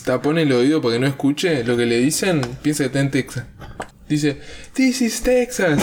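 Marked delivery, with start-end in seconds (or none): 4.7-5.8 high, slow, mock Trump yell; mock shout. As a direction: shout it like an angry tourist. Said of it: "así gritando".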